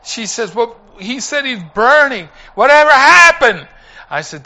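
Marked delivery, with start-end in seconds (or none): 0.5-3.7 high, rising to loud; imitating a shaken nurse; alarmed question. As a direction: blurt it out in retold alarm.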